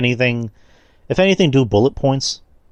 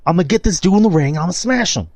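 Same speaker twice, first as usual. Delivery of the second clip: higher, casual trash-talking brag; Roman trash talk; 9 semitones higher than usual. Retold or imitated. Roman trash-talking an opponent among friends, higher casual brag. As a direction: toss off the threat like joking with friends. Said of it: "You know, he's like".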